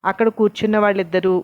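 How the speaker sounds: plain reading voice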